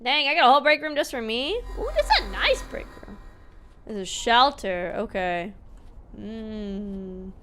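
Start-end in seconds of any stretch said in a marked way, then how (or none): 1.8-3.2 high delighted ooh. Real, not acted. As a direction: coo over the nice room. Admiring the room.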